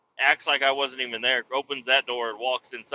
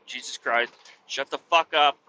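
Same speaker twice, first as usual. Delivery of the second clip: normal talking voice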